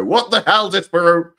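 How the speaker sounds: loud, high-pitched shout of disbelief; mock outraged yell. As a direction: yell it like someone appalled at a friend. Mock outburst quoting another person.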